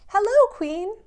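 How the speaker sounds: high, loud sing-song hello; playful kid-pilot greeting; cheery greeting. Acted. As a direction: wave and call out brightly to the Queen. Pilots wave to the Queen.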